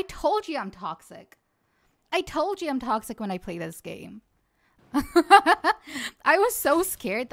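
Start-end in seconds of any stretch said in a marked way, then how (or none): 4.9-7.3 loud laugh into speech; laughing with relief. Real, not acted. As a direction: laugh off the fright as you talk. Laughs off the scare.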